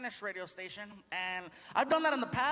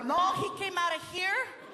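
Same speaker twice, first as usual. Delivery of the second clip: higher, slow, shouted proud mother; proud mom; 8 semitones higher, 3 dB louder, slower than usual. Retold or imitated. Mom bragging to everybody about her son, high and slow. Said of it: "she tells everybody".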